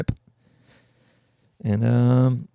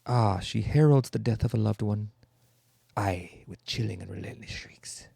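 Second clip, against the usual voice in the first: poetic lament, trailing into a much softer voice; mock poetic recital; 4 dB quieter than usual. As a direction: intone it like a grim poem, fading away. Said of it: "that's very poetic".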